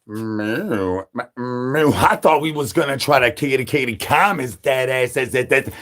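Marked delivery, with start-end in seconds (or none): 0.1-1.9 lower, softer cow moo; a lightly voiced bull mooing; mock cow moo. Bull answers the call.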